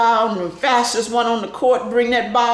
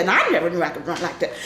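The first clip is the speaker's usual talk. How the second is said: normal talking voice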